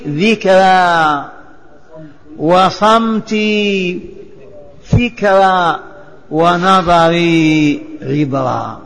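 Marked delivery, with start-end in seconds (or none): none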